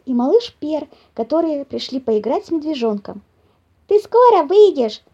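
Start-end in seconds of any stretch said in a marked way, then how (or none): 3.9-5.0 put-on high, loud eager kids' call; squirrel children. A narrator's reading. Squirrel kids calling their bear friend out to play, eager. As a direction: call out like impatient little squirrels. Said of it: "спросили бельчата хором".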